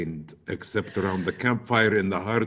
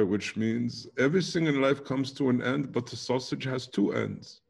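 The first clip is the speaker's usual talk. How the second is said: put-on German-accented English; 3 semitones higher, 4 dB quieter than usual. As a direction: explain the proverb with a German accent. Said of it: "keep going with the German".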